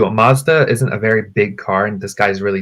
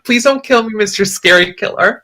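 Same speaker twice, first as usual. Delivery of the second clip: mock scared plea; 10 semitones higher than usual. Pleading with scary passenger.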